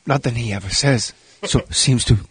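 low, halting, mumbled impersonation; Forrest Whitaker. Acted. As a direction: stall and restart in a low voice. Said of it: "What does, uh, Forrest have to say".

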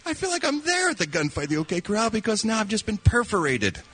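high-voiced mock-excited onlooker; comic imitation of an oblivious spectator; impressed show spectator. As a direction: act out the thrilled bystander, pitched well up. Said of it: "the guy watching the show saying".

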